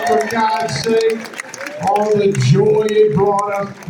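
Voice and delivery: high, slow, loud appeal; milk the crowd with mock outrage; mock-indignant plea. Actor chiding the audience to cheer his film, mock-indignant plea.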